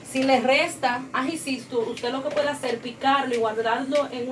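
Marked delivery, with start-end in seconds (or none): none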